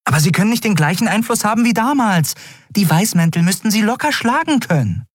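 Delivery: a tiny creature flapping in alarm; small excited Nelen. A narrator's reading. Nelen objects to Keru in a high, hurried voice.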